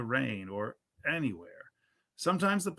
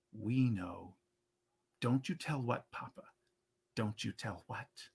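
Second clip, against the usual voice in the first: hushed teasing; 3 semitones lower, 5 dB quieter than usual. A narrator's reading. Child teasing papa, hugging him.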